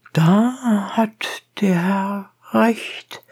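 slow judicial pronouncement in character; the judge's voice. A narrator's reading. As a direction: draw it out, high and deliberate.

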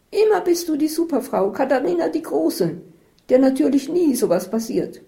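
high, loud reproach; angry sarcasm. Acted. Isabel taunts super-woman Katharina, high angry sarcasm.

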